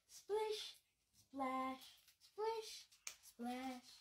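sing-song splashing noises; playful splish splash. Acted. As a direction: bounce the water sounds for kids. Sound words while washing.

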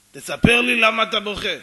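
very loud, forceful order to tell; acted shouting insistence; insistent demand. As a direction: boom it at him, refusing to let go. Stranger insists on an answer.

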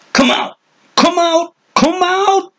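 climbing to a very high shriek on each repeat; old hermit shrieking at someone in hiding; shrill cry. A narrator's reading. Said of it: "The voice shrilled".

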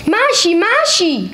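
high calling of a name. Acted. Dummy calling out to Maasje, high and calling. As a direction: call the name out across the room. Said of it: "riep Dummy".

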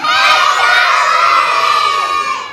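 big high excited gasp. Acted. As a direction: gasp loudly as Charlie appears. Kids shout, host gasps.

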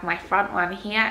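talking with finger in mouth; finger pulling lip. Real, not acted. Seen 0: Finger lifts lip to show gums, repeatedly while talking.

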